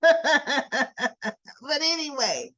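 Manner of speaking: laughing into a drawn-out change of subject; laugh, then move on slowly. Real, not acted. Storyteller amused at her own scolding, laughing.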